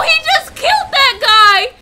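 loud, high shocked yell; panicked yell. Acted. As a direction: shout it in horror, hitting killed. Panicked scream at a killing.

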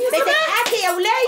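high, loud indignant yell; outraged scolding. Acted. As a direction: yell at her for laughing. Outraged at being laughed at. (زعيق بغضب واستنكار)

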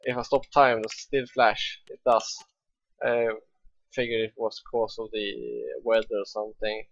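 ordinary talk

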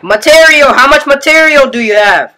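shouted, high and loud; shouting. Real, not acted. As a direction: shout it so they hear. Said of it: "I can barely hear you".

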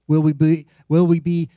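loud, pressing repeated question; rising pulpit emphasis; emphatic preaching. Acted. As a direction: push the question hard at the room.

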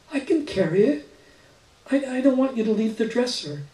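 put-on a small girl eager to help; little girl voice. A narrator's reading.

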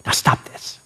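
a gentle but firm push, almost breathed; firm little oomph. Shown as a demonstration. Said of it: "put a little oomph in it right at the end".

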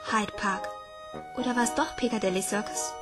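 ordinary delivery

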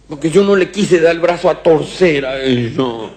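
high, slow, lightly voiced little girl; a hint of a whiny little girl back from school. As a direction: complain like a hurt schoolgirl. Girl answers father.

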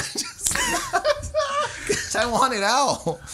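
high laughing delivery. Real, not acted. Comic cracking up at his own story, laughing high. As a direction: laugh through the punchline.

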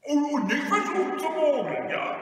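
loud, high robber voice; blustering parting threat; warning robber. Acted. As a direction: warn forcefully against trickery. Robber warns against cheating.

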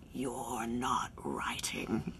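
slow, soft, husky imitation of a man's voice; low and slow, almost whispered; deep smoky voice. Imitating Jason scolding her about theater, slow low whisper. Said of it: "deep, smoky, sexy voice".

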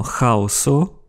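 "chaoso" slowly, starting with a kh rasp; "chaoso" drawn out as kha-o-so; throaty CH. Shown as a demonstration. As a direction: open "chaoso" like German ach.

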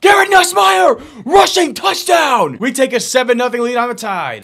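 high, loud, slow announcer-style yell; real triumphant shout; touchdown call. Real, not acted. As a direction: call the score like a hyped commentator. Own touchdown, calling it out.

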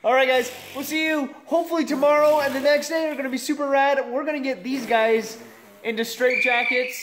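vlog outro. Acted. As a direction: sign off to the viewers with energy.

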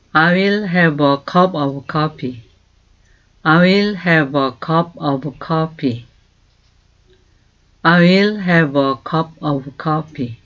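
very slow, clear English for learners to copy; slow model phrase. Shown as a demonstration. Teacher slowly modelling a question. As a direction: stretch each word for repeating. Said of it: "teacher models the phrase".

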